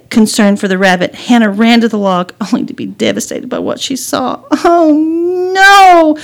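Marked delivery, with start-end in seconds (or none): 4.6-6.2 high, loud, stretched-out dismay; dismayed cry. Acted. Girl finding the rabbit trapped, dismayed. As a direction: wail it in horror. Girl devastated by what she saw.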